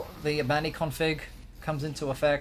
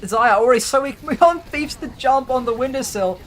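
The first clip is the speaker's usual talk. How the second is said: excited; 8 semitones higher, 8 dB louder than usual. Real, not acted. Casting a sudden team wipe.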